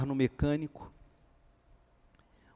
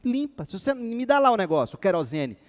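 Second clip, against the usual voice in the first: higher, louder irritated demand; angry order; 8 semitones higher, 4 dB louder than usual. Retold or imitated. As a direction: snap the order at the lazy mechanic. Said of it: "Fiquei com raiva".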